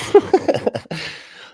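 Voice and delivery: high, loud burst of laughter; helpless cackle of amusement; laughing. Real, not acted. Cracks up at guest's name.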